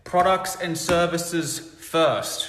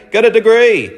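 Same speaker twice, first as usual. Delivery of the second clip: mock parental advice; 7 semitones higher, 9 dB louder than usual. Parents voiced giving advice.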